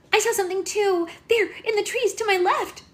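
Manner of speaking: high, loud frightened cry; girl screaming to the group that she saw something. Acted. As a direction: shriek it out in fright. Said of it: "Red screamed".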